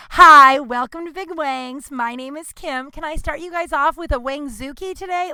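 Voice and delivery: high-pitched, put-on customer-service voice; scripted server greeting. Server greeting a table at Big Wangs, put-on perky welcome. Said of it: "as a server, you have to be like".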